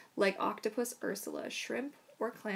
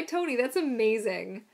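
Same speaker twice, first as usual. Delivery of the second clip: ordinary talk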